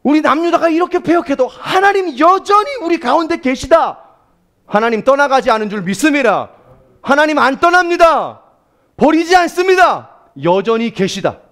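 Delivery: 스바냐's impassioned declaration; voice of Zephaniah. Acted. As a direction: preach as the prophet crying faith. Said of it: "스바냐가 이 얘기를 하는".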